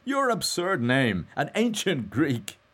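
high, loud, slow teasing. A narrator's reading. Mulligan teases Stephen about his absurd name, gaily. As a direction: tease gaily, stretching the words. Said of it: "he said gaily".

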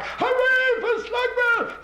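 high, slow, far-off crowd cheering; piping crowd voice shouting hooray. Acted.

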